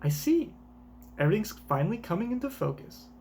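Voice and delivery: put-on high, fast Valley Girl voice; chirpy, quick Valley Girl read. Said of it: "that Valley Girl voice too".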